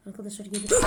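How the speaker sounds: loud alarmed warning; loud startled warning to the viewers; panicked alarm. Real, not acted. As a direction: sound the alarm, she's coming. Granny approaching, panic.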